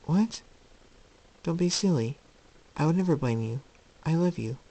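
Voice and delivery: high, gentle motherly voice; loving mother, reassuring. Acted. Luna telling her son she blames him for nothing, tender. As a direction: start surprised, settle into tender reassurance.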